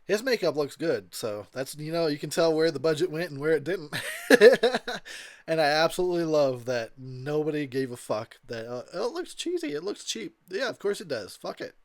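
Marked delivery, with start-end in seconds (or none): 4.0-5.4 a big burst of laughter; a real hearty laugh; loud laugh. Real, not acted. 8.9-10.4 a much higher, sneering critic voice; mock whiny reviewer. Mocking imagined critics.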